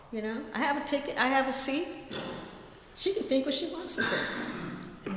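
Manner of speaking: I have my seat, her opinion is not my problem; dismissive tone. Real, not acted. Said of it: "you also hear the tone in my voice".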